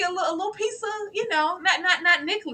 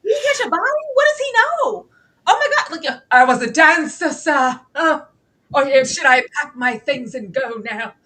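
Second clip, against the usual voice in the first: panicked excited; 2 semitones higher, 4 dB louder than usual. Retold or imitated. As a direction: spill the panic out fast. I was like.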